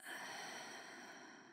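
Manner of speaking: long, soft exhale; soft sigh. Acted. Partner pausing before voicing concern, soft sigh. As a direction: let out a slow, quiet sigh.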